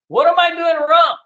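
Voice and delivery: normal talking voice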